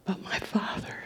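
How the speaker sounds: a barely voiced whisper; hushed whisper. Preacher to the congregation, hushed whisper. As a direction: drop to a whisper before the climax.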